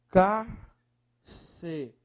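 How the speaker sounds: "Carcerária" in very slow, separated syllables. Shown as a demonstration. Teacher separating the word on request, syllable by syllable. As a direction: break "Carcerária" apart, pausing after every syllable. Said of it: "Separe, por favor".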